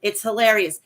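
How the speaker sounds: normal talking voice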